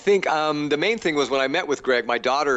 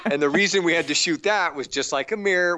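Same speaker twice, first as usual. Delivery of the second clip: ordinary talk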